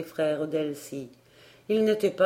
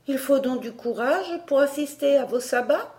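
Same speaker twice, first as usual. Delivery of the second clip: put-on taunting girl; 7 semitones higher than usual. A narrator's reading. Elsie mocking challenge.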